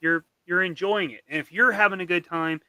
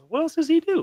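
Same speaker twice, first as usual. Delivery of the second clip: curious; 14 semitones higher, 4 dB louder than usual. Retold or imitated. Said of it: "And then I was like".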